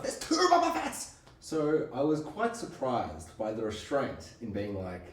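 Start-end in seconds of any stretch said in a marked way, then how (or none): 0.0-1.1 high, excited squeal of delight; thrilled exclaim. Real, not acted. A podcaster gushing to his co-host, squealing with delight. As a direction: blurt it out like a giddy fan. Fan gushing about the episode.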